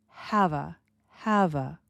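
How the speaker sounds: "have a" run together as one smooth unit; blended have a. Shown as a demonstration. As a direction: link "have a" without a break. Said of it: "look at the words have a".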